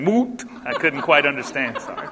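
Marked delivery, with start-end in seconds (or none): 0.0-0.4 put-on Moot with a long oo, northern accent; teasing northern Moot for moat; mock northern accent. Teasing the congregation's northern accent for moat. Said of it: "It was that northern accent".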